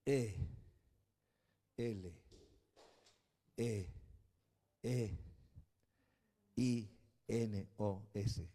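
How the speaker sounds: E L E I N O S spelled letter by letter, slow and soft; spelling out letters. Shown as a demonstration. Pastor spelling the Greek word for the church, slow letter by letter. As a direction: spell each letter apart, slowly. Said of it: "Del griego".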